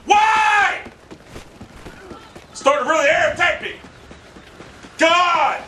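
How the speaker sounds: high, drawn-out frustrated shouts; upset shouting. Real, not acted. Gamer fed up with the game, upset shouting. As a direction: shout in real irritation. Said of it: "I'm getting really upset".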